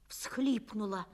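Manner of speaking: ordinary delivery, nothing marked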